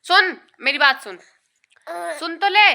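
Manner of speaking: high, loud call for attention; loud insistent call. Real, not acted. Calling distracted baby.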